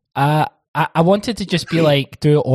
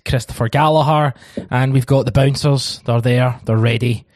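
normal talking voice

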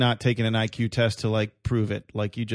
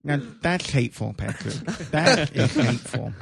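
mocking speech impediment voice. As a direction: talk like your tongue is too big. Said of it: "a tongue that's too big for his mouth".

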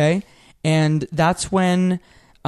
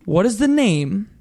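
bright quiz host announcing; game show host voice; 2 semitones higher than usual. Acted. Hosting the quiz.

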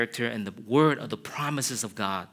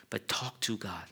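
whisper; 3 semitones lower, 10 dB quieter than usual. Real, not acted. Whispered emphasis.

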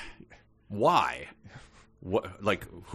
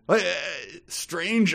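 usual speaking voice

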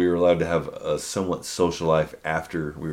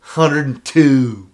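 guessing weight; 5 semitones higher, 7 dB louder, much slower than usual. Retold or imitated. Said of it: "What do you think this guy weighs".

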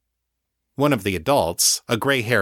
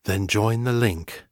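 solemn elder voice. Acted. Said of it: "full of a weighty solemnity".

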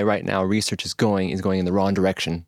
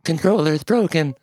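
singing-software robot, high; emotionless robot; 7 semitones higher than usual. Pretend. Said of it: "We could pretend we're robots".

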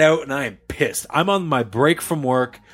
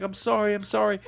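small, higher, softer imitated apology; concerned apology; 7 semitones higher, 6 dB quieter than usual. Storyteller imitates the man being yelled at, meek concerned apology. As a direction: apologise meekly, softly, twice over. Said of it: "he's so concerned".

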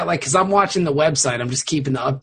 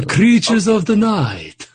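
loud, slow, high-pitched vampire declamation; hammy vampire voice; Gary Oldman's Dracula; 4 semitones higher, 6 dB louder, slower than usual. Acted. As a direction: draw it out grandly like a theatrical Dracula. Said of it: "Gary Oldman from, uh, Bram Stoker's Dracula".

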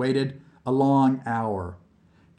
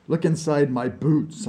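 usual speaking voice

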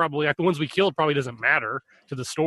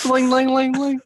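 mock shop bell; 9 semitones higher, 5 dB louder than usual. Shopkeeper greeting bell sound.

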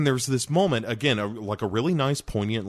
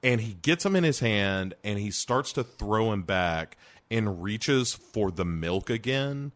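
ordinary talk, nothing marked

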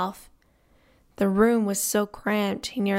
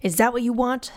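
angry challenge; 4 semitones higher than usual. A narrator's reading. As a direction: challenge him to fight. Galen threatens Fingal.

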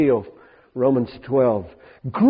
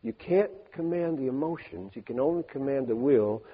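quiet musing; 10 dB quieter than usual. Real, not acted.

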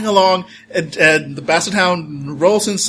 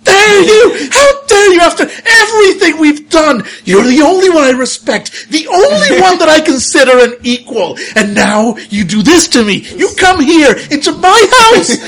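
high, loud shrieking rant of a wounded fox woman; furious fox spirit; 13 semitones higher, 10 dB louder than usual. Acted. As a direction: scream the betrayal at the dog, pitched way up. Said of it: "And, and that just like".

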